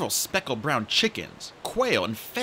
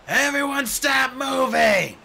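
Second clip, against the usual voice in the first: slow, loud, higher commanding shout; Clay roaring for order; bellowing; 12 semitones higher, 6 dB louder, slower than usual. Acted. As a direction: bellow over a noisy cave. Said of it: "he bellowed".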